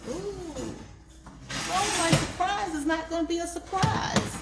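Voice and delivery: high, bright mock dismay; surprised squeal. Real, not acted. Cheese burst out of meatballs.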